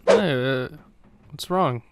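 normal talking voice

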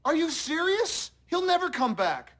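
high, shocked outburst; alarmed disbelief. Acted. Parent hearing the child ran off, alarmed. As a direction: voice shoots up in dismay.

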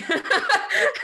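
tearful high laugh; high burst of laughter. Real, not acted. Moved to tears and laughter.

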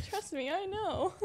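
slow, laughing through the words; a pained laugh at her own joke; rueful laugh. Real, not acted. Bitter joke about infertility.

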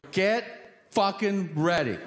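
high, pumped-up warning; amped up. Retold or imitated. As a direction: punch it out, bursting with anticipation. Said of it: "I'm so amped up".

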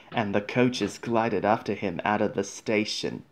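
usual speaking voice

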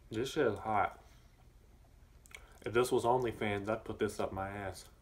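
talking through a mouthful of chicken; chewing while talking; mouth full. Real, not acted. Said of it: "Talking with my mouth full".

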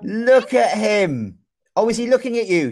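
ordinary talk

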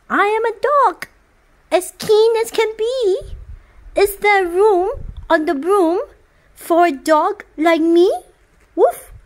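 a bouncy dog begging to come along; eager dog. Acted. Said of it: "then eagerly said".

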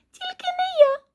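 very high tiny-mouse squeak; teeny, high-pitched mouse voice. A narrator's reading. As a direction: squeak the refusal out. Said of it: "пропищав Верть".

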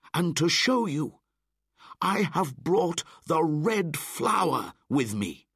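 high, loud defiant speech; angry boy. Acted. Mowgli defying the wolves with fire, angry. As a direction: hurl it at the pack. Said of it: "He was very angry and very sad".

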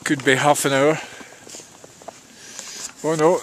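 ordinary delivery

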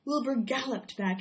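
usual speaking voice

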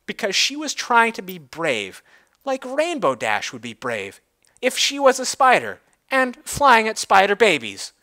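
plain reading voice

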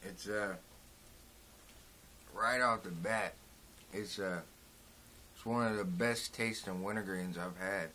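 talking around tobacco pinch; slightly thick lip speech. Real, not acted. Seen 0: Hand at mouth before; lower lip looks packed while speaking.

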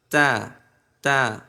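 isolated, carefully placed "তা"; model "তা" pronunciation. Shown as a demonstration. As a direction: separate "তা", tongue at the root of the teeth. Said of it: "দেখেন আমার জিহ্বা".

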